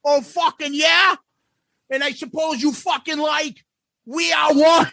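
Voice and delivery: high-pitched, challenging Aussie impression; mock a drunk Australian's challenge; pugnacious imitation Aussie accent; belligerent drunk Australian. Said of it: "belligerent-looking, drunken, uh, uh, Australian guy".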